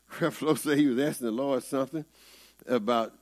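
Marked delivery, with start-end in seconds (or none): none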